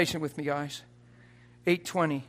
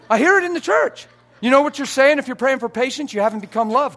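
much higher and louder, near shouting; fiery preaching; 10 semitones higher, 14 dB louder than usual. Acted. Preacher rebuking the church's prayer for patience, shouting. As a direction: blast it at the congregation.

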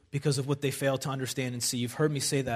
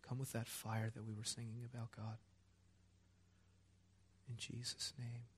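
murmured, hushed prayer; soft prayer; 3 semitones lower, 15 dB quieter than usual. Real, not acted. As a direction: pray softly over her. Quiet prayer over her.

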